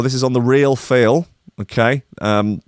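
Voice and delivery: usual speaking voice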